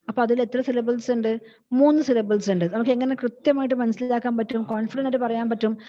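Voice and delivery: usual speaking voice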